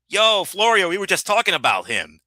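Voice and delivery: high, eager hail to a friend; retold excited call. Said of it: "we were like".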